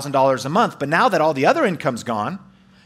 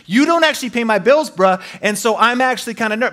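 much higher, louder mock complaint; exasperated; 8 semitones higher, 3 dB louder than usual. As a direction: gripe at the friend who doesn't pay the bills. Venting at friend.

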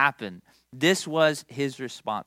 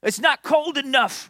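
half-volume retold tirade, pitched up; mock yelling boss; 8 semitones higher than usual. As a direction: imitate a tough boss berating the staff. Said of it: "he'd just yell at us".

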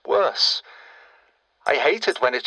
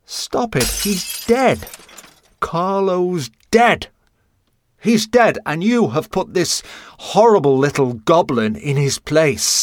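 higher, louder, furious outburst; angry shouting; 6 semitones higher, 4 dB louder than usual. Acted. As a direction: yell at him in grief. Mother breaks down over son.

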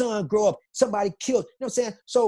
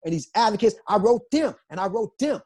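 ordinary delivery

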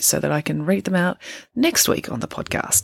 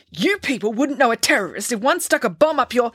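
high-pitched angry rant; heated, higher-voiced outburst; screamed tirade; 7 semitones higher than usual. A narrator's reading. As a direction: hurl it as a furious tirade, short of a real scream. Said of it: "she screamed this at him".

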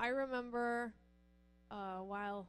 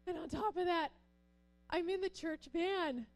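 higher and louder, frantic; frantic pleading; 8 semitones higher, 6 dB louder than usual. Retold or imitated. Pastor's wife pleading with the officer, frantic and higher. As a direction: pile on the embarrassment in a rush. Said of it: "And I was like".

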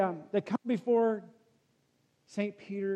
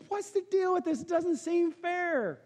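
complaining pastor; 10 semitones higher than usual. Retold or imitated. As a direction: plead the unfairness, hammed-up. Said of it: "He says".

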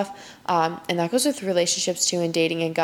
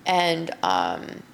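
normal talking voice

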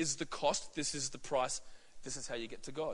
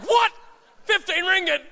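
shouted, much higher disbelief; shocked; 11 semitones higher, 9 dB louder than usual. Retold or imitated. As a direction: yelp at the price in total shock. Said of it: "I'm like".

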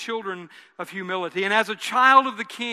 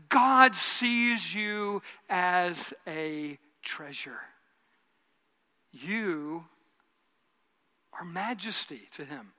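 tender reassurance; 2 semitones lower, 3 dB quieter, slower than usual. Acted. Tender sermon climax.

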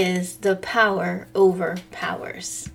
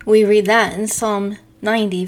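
usual speaking voice

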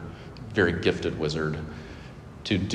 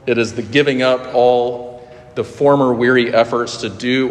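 louder, fervent reading of the quote; emphatic quoted reading; 4 semitones higher, 6 dB louder than usual. Retold or imitated. Preacher quoting Horatius Bonar to the church, louder and fervent. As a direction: proclaim it like a confession of faith. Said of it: "He says it like this".

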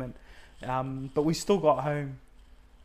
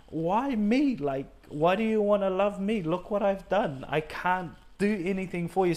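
higher, pleading, retelling the breakdown; asking God why, higher and urgent; emotional plea; 5 semitones higher than usual. Retold or imitated.

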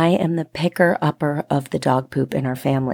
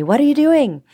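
higher, louder call across the yard; child's yell; 10 semitones higher, 4 dB louder than usual. Retold or imitated. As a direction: shout it like a boy playing outside. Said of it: "he yells to me".

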